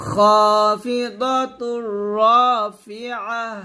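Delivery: slow melodic খাফিদাতুর রাফিআহ; recite for repetition. Shown as a demonstration. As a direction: model it for students. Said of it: "আমার সাথে বলেন". (শিক্ষার্থীদের জন্য ধীরে)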